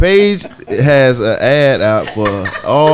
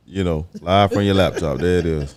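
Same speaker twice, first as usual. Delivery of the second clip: low, soft late-night radio host; romantic voice; 10 semitones lower, 7 dB quieter than usual. Shown as a demonstration. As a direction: purr it low and smooth. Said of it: "I can put on my romantic voice".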